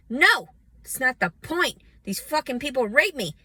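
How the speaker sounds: loud, high, angry shouting; furious shout. Real, not acted. Answering people who say she should wait for child support, furious shout. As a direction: shout it back at them in fury.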